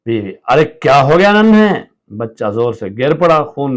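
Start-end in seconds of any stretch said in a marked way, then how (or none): 0.5-1.8 high loud call; alarmed call. Acted. Mother calling out to her fallen son, loud. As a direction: call out to the hurt child.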